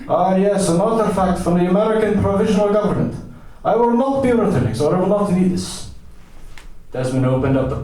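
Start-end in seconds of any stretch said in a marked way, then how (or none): 0.1-5.9 high, pompous dismissal; lofty, defiant madman; deranged American eccentric. Acted. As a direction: declaim it grandly in a put-on high voice.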